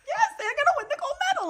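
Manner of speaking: very high, fast, excited cry; retold screaming. Fan defending the curling obsession, excited scream. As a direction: squeal it out in a rush.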